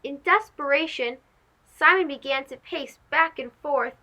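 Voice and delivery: plain reading voice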